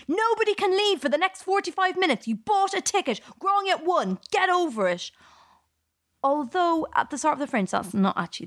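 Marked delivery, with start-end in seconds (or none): 0.1-5.1 mock scream. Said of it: "lock the doors ... and scream".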